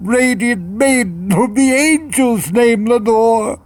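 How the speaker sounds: high, loud, anguished begging; desperate plea. Acted. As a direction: cry out to the bird for an answer. Narrator begs the raven again.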